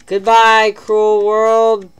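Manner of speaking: loud, high theatrical goodbye; mock dramatic farewell. Gamer hamming up his doomed character, loud and theatrical. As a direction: ham up a tragic farewell to the world.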